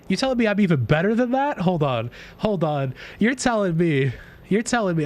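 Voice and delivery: high hyped bragging; thrilled, can't believe it; excited disbelief. Real, not acted.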